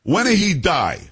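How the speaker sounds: high-pitched mock Italian accent; thick Italian accent. Woman bluntly asks about grandfather's death, imitated Italian accent. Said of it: "with a thick, uh, um, Italian accent".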